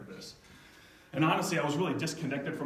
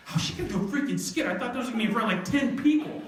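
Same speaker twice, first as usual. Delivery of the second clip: high-pitched fretting; retold panic climbing higher as it goes; worked-up, incredulous parent; anxious disbelief; 8 semitones higher, faster than usual. Retold panic about the skit.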